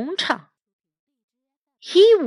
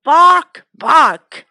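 loud barking dog sounds, the first one higher; 3 semitones higher, 5 dB louder than usual. Acted. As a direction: bark it out like a dog. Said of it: "went the dog".

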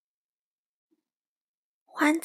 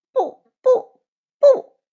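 much higher, much louder shrieking refusal; screaming no; 13 semitones higher, 12 dB louder, much slower than usual. A narrator's reading. Crow refusing to hand over the sauce bowl, screaming. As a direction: shriek it like a furious child. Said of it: "花袜子大叫着".

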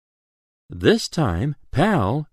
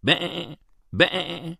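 higher, softer bleating; sheep bleat; 6 semitones higher, 5 dB quieter than usual. A narrator's reading. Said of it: "says a sheep".

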